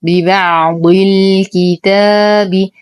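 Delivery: ببعض الكتاب with the ع held and a long ا in الكتاب; slow tajweed recitation. Shown as a demonstration. Teacher recites the phrase for students, slow measured chant. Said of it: "نصبر على اللام".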